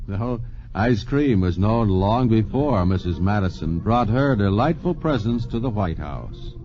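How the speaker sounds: usual speaking voice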